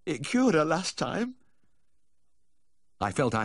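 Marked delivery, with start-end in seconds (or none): 0.1-1.3 high, slow plea; imploring, drawn-out high voice; pleading. A narrator's reading. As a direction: press the request plaintively. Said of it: "he pleaded".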